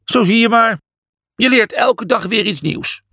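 high, cheerful shrug-off; self-satisfied. A narrator's reading. Julius unbothered by Imhotep's fury, contented. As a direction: answer breezily in a high voice. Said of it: "Julius knikte tevreden".

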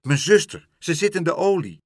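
high, loud distressed report; urgent alarm. A narrator's reading. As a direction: blurt out the emergency. Gull reports sister in trouble. (dringend, hoog en luid)

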